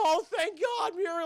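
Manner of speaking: high, loud cry of relief; gushing relief. Retold or imitated. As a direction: act out hammed-up cartoon gratitude. Rescued character thanks Muriel.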